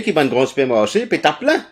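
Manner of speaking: normal talking voice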